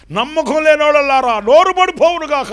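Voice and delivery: loud, high thundering decree; angel's curse. Retold or imitated. Angel cursing the unbelievers, loud and high. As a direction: pronounce it like a judgement.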